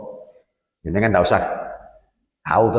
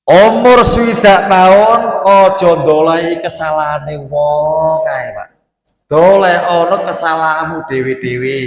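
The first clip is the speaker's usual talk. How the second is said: much louder and higher, slow sermon cry; preaching at full force; 5 semitones higher, 7 dB louder than usual. Acted. Preacher crying out to the elderly about their own faults, loud and slow. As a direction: shout the warning slowly to the congregation.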